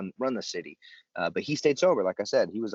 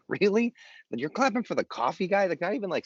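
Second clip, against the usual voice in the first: high-pitched mock disbelief; exaggerated, high incredulous protest; 11 semitones higher than usual. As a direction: question it as if it were absurd. Mock disbelief at clapping for coffee guy.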